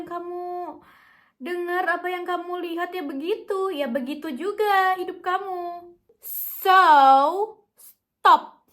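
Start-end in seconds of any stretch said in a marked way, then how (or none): 6.2-8.7 louder, commanding halt; firm loud stop. Real, not acted. Firm order to quit sad songs. (perintah tegas dan keras)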